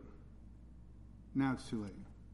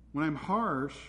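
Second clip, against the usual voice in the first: ordinary talk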